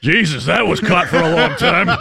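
loud, high, through laughter; laughing. Real, not acted. Radio host joking about his own growl, laughing loudly. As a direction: crack up while getting the words out.

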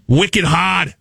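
mock Boston slang, dropped r; Boston accent. Shown as a demonstration. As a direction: put on a brief Boston accent. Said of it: "is also Boston".

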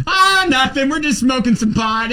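high, laughing mock kid voice, breezy; imitating the boy. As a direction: play the unbothered little boy, high. Host voices the kid casually.

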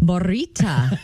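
Morita met een rollende r; rolled r. Shown as a demonstration. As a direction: morita with a trilled r. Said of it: "say morita again".

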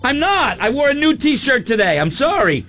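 high, shouted protest; hammed-up exasperated yell; indignant shout. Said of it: "I'm like".

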